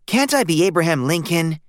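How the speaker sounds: put-on boy pleading. A narrator's reading. Stanley pleads with his parents for a different costume, boy's pleading voice. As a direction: beg to be Abraham Lincoln. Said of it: "Stanley pleaded".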